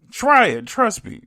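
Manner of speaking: high, loud pitch of insistence; pleading high and loud with the audience; excited urging. Real, not acted. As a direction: sell it hard, voice jumping up. Urging listeners to try tacos.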